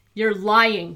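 villain roars in rage; roaring. A narrator's reading. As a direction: bellow the accusation. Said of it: "Fiddler roared".

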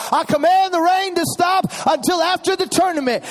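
put-on boy on game day ordering the rain; child commanding rain. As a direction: boss the clouds around. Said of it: "he's like".

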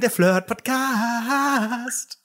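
slow, high jingle announcer; radio announcer voice. Pretend.

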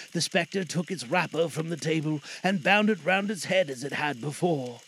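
ordinary delivery, nothing marked

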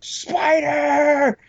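high mock shriek of a scared little boy; screaming brother. Little brother shrieking at a daddy long legs, high mock scream. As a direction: shriek it like a kid terrified of a spider. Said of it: "my brother is just like".

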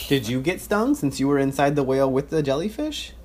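normal talking voice

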